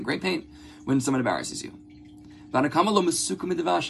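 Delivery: ordinary delivery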